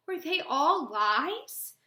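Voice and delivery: high, slow, loud disbelieving question; stretched-out, upset questioning; voice rising. Acted. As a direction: let the pitch climb sharply to the end. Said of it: "My voice rises".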